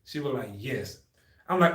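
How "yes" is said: very soft, low "yes"; imitating curt examiner. Examiner answering shocked recruit, deadpan. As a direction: drop it deadpan, with finality.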